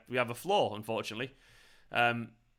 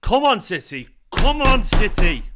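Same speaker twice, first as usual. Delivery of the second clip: loud, high urging yell; real football fan's shout; rallying shout; 7 semitones higher, 12 dB louder, slower than usual. Real, not acted. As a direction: roar your team on, louder the second time. Cheering on his team while watching.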